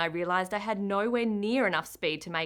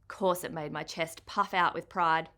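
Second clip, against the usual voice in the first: usual speaking voice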